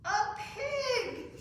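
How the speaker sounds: giggling through the words; laughing. Real, not acted. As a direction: laugh while asking. Laughing while introducing pig.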